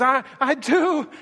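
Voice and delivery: high-pitched, stammered cry of belief. Acted. Father answering Jesus about his faith, crying out. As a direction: cry out in desperate insistence. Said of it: "the boy just cries out immediately again".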